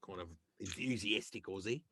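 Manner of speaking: a hint of Australian character voice; Aussie accent. Shown as a demonstration. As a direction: slip briefly into an Aussie accent. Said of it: "like, an Aussie character".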